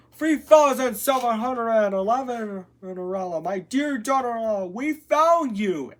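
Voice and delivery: high, loud joyful exclamation; exclaiming king. A narrator's reading. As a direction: exclaim it as an overjoyed storybook king. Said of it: "exclaimed the king".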